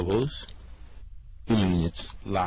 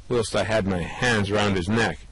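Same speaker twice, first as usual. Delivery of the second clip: ordinary delivery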